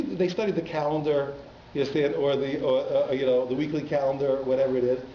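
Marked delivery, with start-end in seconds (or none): none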